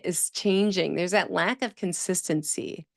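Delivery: usual speaking voice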